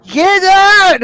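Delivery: high, loud battle yell; full-voiced charging shout; screaming. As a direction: scream it as you charge through the door. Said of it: "starts screaming".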